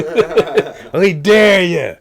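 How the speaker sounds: high, loud laughing taunt. Retold or imitated. Teasing the grandpa in a retold joke, laughing taunt. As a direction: crow it through a laugh.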